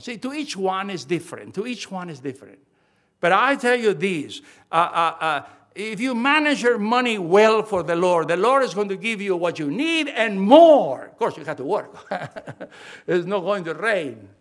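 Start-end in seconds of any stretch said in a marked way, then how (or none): 3.2-11.1 loud preacher's proclamation, swelling to the last word; loud, emphatic pulpit delivery; full-force preaching. Real, not acted. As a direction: drive the promise home to the congregation.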